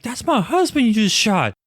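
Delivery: high-pitched mock outrage; high, indignant retold complaint; protesting wife. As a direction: play an upset wife, pitched well up. Acting out wife protesting the shooting.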